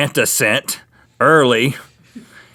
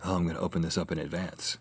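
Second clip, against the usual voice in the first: put-on imagined boy's aside; 8 semitones lower, 16 dB quieter than usual. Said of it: "He's like".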